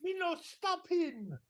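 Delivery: put-on high, slow, broken-English Italian accent; mock Italian accent. Italian criminal refuses the policeman's order, high mock Italian accent. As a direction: play a stubborn hammed-up Italian. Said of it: "the accent is something else".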